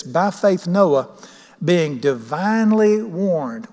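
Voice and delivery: slow, weighty reading of the verse; slow scripture reading. Shown as a demonstration. Preacher reading verse to congregation, slow and weighty. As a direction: lay out each word of scripture slowly.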